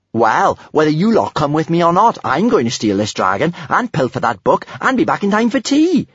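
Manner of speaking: put-on high, determined young adventurer; breezy Kamikaze, defiant. Voices Kamikaze in dialogue.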